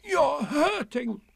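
high, slow wail of protest; hammed-up high-pitched complaint; pained cry. A narrator's reading. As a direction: cry out, stretching the words. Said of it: "cried Pooh".

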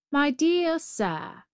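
slow, high patient road voice; drawn out like a weary old road; the road speaking. Acted. The road answers the Brahmin, slow and high.

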